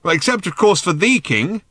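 high, loud afterthought; shouting. A narrator's reading. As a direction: yell it with weight on "the". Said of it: "shouted Ford".

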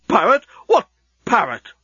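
high, loud incredulous outburst; aghast disbelief. Acted. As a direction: repeat it in rising outrage. Aghast reaction to worker's excuse.

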